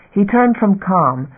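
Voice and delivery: ordinary delivery, nothing marked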